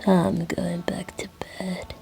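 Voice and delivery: put-on slow, drowsy drunk mumbling; drunk Crowley. A drunk waking up briefly before going back to bed, slurred and slow. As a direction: trail off softly, half asleep. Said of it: "with his drunk, snaky self".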